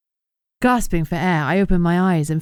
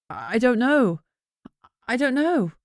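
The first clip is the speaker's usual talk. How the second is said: higher, breathless, shaken repeat; stammering in panic; 7 semitones higher, slower than usual. A narrator's reading. Beatrix answering Yanni in panic, stammering higher. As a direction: stammer it out, barely holding together. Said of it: "I stammered".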